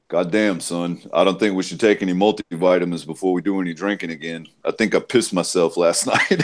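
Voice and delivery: low voice imitating an intimidating father; imitated dad, low and grave, breaking into a laugh at the end; deep-voiced dad. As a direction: drop down into a big dad's deep voice. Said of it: "his voice is so fucking deep".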